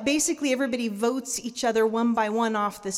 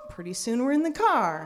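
normal talking voice

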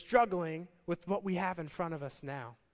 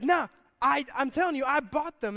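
exasperated insisting; 8 semitones higher, 7 dB louder than usual. Retold or imitated. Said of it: "he's like".

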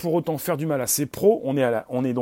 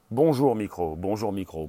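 dropping much lower, like an echo; 7 semitones lower than usual. Acted. Host repeats the greeting to the microphone, low echo. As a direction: repeat it in a deepening voice.

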